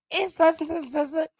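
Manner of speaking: very high acted reply; phone call reenactment. Friend naming the child's school, high-pitched acting. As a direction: answer in a high mimicked phone voice. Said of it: "play back the phone conversation".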